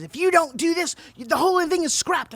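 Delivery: angry producer yelling a threat; retold screaming. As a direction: shout the ultimatum at her. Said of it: "Sully starts screaming at me".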